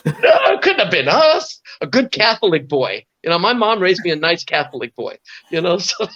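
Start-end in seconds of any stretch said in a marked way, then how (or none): 0.1-1.6 laughing denial. Real, not acted. Joking denial.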